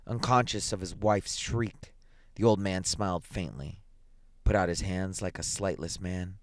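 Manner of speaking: plain reading voice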